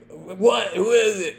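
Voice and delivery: put-on high, sleepy drunk woken up; groggy drunk. Drunk suspect woken by knocking, high and groggy. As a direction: act out the dazed guy behind the door. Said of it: "He's like".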